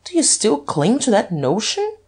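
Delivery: plain reading voice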